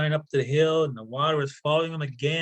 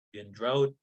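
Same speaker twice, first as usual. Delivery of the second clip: plain reading voice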